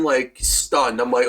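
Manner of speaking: ordinary talk, nothing marked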